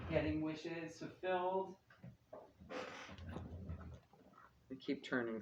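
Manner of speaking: muffled, turned away from the mic. Real, not acted. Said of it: "I'm probably getting muffled".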